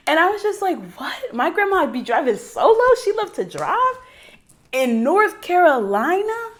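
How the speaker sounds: high-pitched incredulous astonishment; amazed disbelief. Real, not acted. Granddaughter amazed her grandmother still drives, incredulous. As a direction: pitch soaring, marvelling at it.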